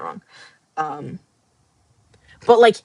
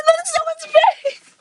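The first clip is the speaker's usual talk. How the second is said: high teary whine; real tearful reaction; crying voice. Real, not acted. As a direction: say it half sobbing. Emotional reaction reading webtoon.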